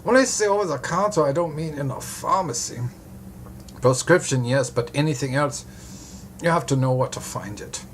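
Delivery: put-on German-accented doctor clarifying; broad German accent. A narrator's reading. Doctor corrects detective on over the counter, German accent. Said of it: "he says in a broad German accent".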